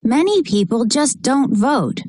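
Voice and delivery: ordinary delivery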